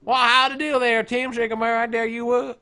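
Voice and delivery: hammed-up, garbled southern drawl. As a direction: slur it like a mock southerner. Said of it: "say this in a southern drawl".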